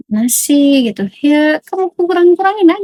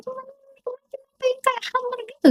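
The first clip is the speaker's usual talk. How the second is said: sudden whisper; 13 semitones higher, 10 dB quieter than usual. Real, not acted. Telling viewers how foreigners eat, in a whisper. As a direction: drop to a high, soft whisper. Aside about foreigners' food.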